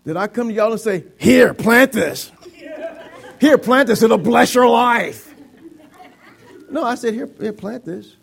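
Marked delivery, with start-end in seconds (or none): 1.2-5.3 loud, drawn-out mock order; grudging command. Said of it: "I didn't give it to you grudgingly". 7.1-8.1 soft, kindly hand-over; nice and kind. Shown as a demonstration. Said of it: "I gave it to you nicely and kindly".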